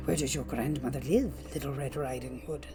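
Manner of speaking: low, soft wolf voice; quiet, low storybook wolf; sly wolf. A narrator's reading. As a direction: greet her as the lurking wolf, hushed and low. Wolf questions the girl.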